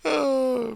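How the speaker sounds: squeaky high laugh. Real, not acted. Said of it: "stupid singing and laughing at my own jokes".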